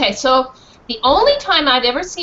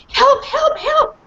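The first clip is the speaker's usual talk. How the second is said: high, loud yelping help; dog barking help, very high; 13 semitones higher, 4 dB louder, slower than usual. Acted. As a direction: yelp like a frantic little dog. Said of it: "I barked again and again".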